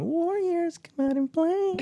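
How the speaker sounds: creepy high falsetto taunt; weird lady voice. Retold or imitated. Co-host imitating the movie villain clinking bottles, in a weird lady voice. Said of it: "screaming from the car in his weird lady voice".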